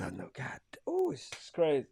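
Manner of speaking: breathy whispered aside. Real, not acted. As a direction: drop to a whisper, overwhelmed. Overwhelmed by too many shout-outs.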